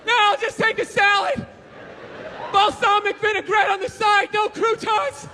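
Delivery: high-pitched, put-upon dieter voice; miserable dieter. Acted. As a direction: play a starving model at the end of their rope. Acted starving dieter turns down cake.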